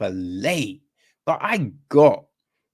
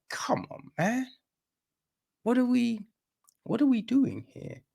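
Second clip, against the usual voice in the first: exasperated; 11 semitones higher, 5 dB quieter than usual. Retold or imitated. Exasperated reaction voiced as quote.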